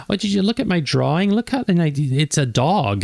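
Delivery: put-on high, fast eager child voice, slowing at the end; gushing little kid tugging at a busy parent; excited kid. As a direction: play a kid proudly showing off a drawing. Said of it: "your kid comes up and, like".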